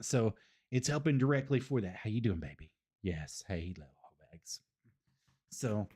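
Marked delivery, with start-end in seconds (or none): 2.0-4.6 talking to puppies. Real, not acted. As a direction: coo at the puppies.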